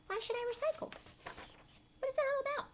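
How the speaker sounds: put-on high, puzzled child voice; little boy voice. Teacher reading the title in a high kid voice. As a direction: ask it like a small curious boy.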